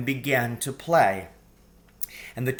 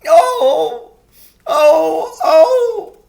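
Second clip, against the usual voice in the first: loud wailing sobs; 13 dB louder, much slower than usual. A narrator's reading. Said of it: "sobbed Mister Tumnus".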